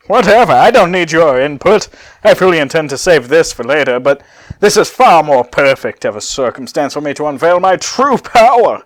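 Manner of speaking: loud gloating villain; loud gloating Cell voice; villain monologue. Acted. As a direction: boast it loudly like a cocky android. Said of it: "As Cell monologues".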